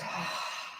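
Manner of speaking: long airy exhale; demonstrated relaxing sigh; big sigh out. Shown as a demonstration. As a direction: let the breath go in a voiceless sigh. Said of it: "a big sigh out".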